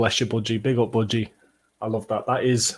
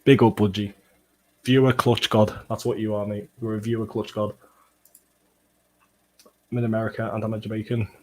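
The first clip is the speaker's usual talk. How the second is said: ordinary talk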